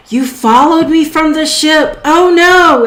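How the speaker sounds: high, loud cry of panic; hammed-up alarm, pitched high and loud; alarmed doctor. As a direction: blurt it out in startled dismay. Game master voices the startled doctor.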